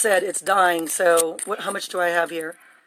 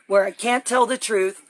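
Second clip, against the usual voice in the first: usual speaking voice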